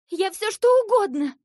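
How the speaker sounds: high, loud, eager outburst; hurried eagerness. A narrator's reading. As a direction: burst out like a desperate child. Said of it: "заторопилась Ася".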